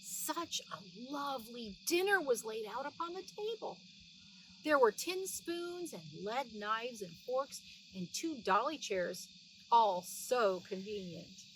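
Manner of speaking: usual speaking voice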